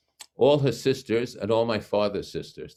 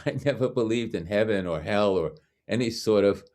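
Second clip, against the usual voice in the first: ordinary talk, nothing marked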